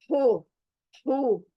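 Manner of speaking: HO from the throat; throat sound. Shown as a demonstration. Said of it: "हलक के ऊपर again". (हलक से निकला हो)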